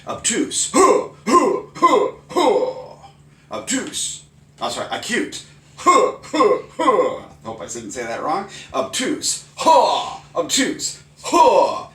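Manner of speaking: loud terms each with a high karate huh; karate chant. Shown as a demonstration. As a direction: shout each word then grunt like a karate strike. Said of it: "I think the grunt really helps".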